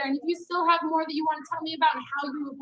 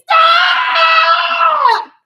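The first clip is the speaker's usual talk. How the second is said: long, high, full-volume yell; yelling sibling; 11 semitones higher, 13 dB louder than usual. Acted. Coach acts out a picked-on kid in the car, yelling. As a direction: explode at a sibling who keeps picking on you. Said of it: "The person who does the yelling".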